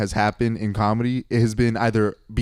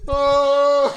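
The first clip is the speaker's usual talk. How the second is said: high, long mock-blissful "oh"; awed "oh"; 11 semitones higher than usual. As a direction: let out a high sigh of wonder. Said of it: "And you're like".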